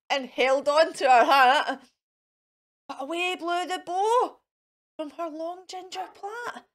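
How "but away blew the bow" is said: ordinary delivery, nothing marked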